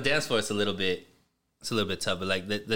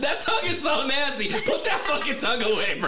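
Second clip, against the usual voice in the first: high, laughing protest; cracking up at the tongue; high laughing mock outrage; laughing mock disgust; 9 semitones higher than usual. Real, not acted.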